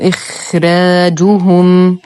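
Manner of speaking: إخراجهم with a heavy خ and a light ر, slowly; slow tajweed recitation. Shown as a demonstration. Teacher recites the word for students, slow measured chant. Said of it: "نفخم الخاء".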